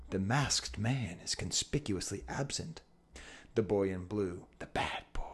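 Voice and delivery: hushed, solemn storyteller voice; dramatic narration. A narrator's reading. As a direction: narrate it like a film critic, quietly. Dramatic retelling of a commercial.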